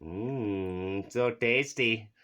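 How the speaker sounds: ordinary delivery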